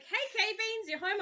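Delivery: high chirpy neighbor voice; chirpy villager. Retold or imitated. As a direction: call it out brightly. Poppy voiced at the door.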